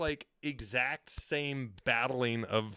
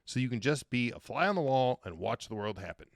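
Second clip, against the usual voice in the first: ordinary delivery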